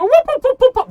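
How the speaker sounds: high loud whooping; mock siren. GM playfully shutting down a player's voice. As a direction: blare it like an alarm. GM cutting off voice play.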